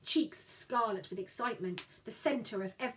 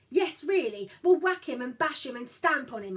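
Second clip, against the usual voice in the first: eager; 3 semitones higher, 8 dB louder than usual. Acted. Child insisting eagerly.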